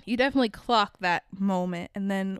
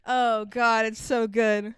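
ordinary delivery

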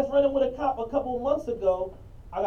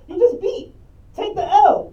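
high and loud, telling them to accept the loss; exasperated outburst; 11 semitones higher, 9 dB louder, slower than usual. Real, not acted. Fed-up storyteller telling the cops off, in a loud, high outburst. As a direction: push it up sharp, fed up.

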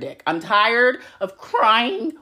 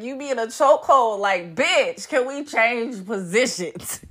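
ordinary delivery

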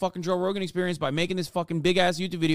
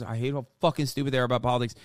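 ordinary delivery

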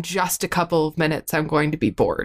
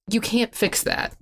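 ordinary delivery

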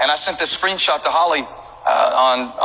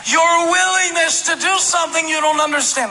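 full-force preaching; 14 semitones higher, slower than usual. Acted. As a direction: preach it out with rising fire. Preaching emphasis.

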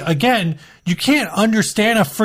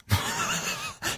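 airy unvoiced wheeze of laughter; breathy high laugh; 13 dB quieter than usual. Real, not acted. Laughing at perverted ska joke.

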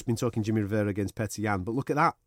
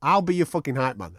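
ordinary talk, nothing marked

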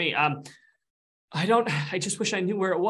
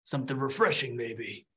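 weary lava ghost; 2 semitones lower than usual. Acted. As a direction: drag out each word, listless. Lava ghost speaks slowly.

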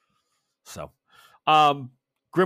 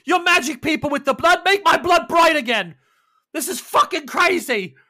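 much higher, louder furious yell; panicked shout; 14 semitones higher, 9 dB louder than usual. Acted. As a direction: throw the words back in a yell. Panicked vampire yelling at her.

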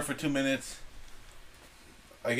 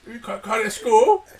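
put-on Chinese restaurant accent; 7 semitones higher, 6 dB louder than usual. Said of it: "Why do you sound like the guy at the Chinese restaurant?".

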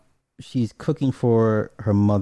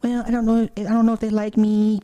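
put-on high, hesitant girl's voice; shy little girl; 10 semitones higher, 3 dB louder than usual. Luisa shyly admitting her crush to Hillary, high and hesitant.

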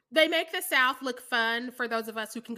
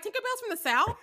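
put-on childhood confusion; 9 semitones higher than usual. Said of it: "being like".

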